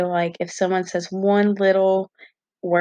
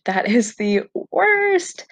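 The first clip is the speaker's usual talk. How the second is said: drawn-out, higher-pitched dismay; emphatic exasperation; 4 semitones higher, slower than usual. Real, not acted. Venting to viewers about sweating and trembling, exasperated. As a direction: stretch out WORST with real exasperation.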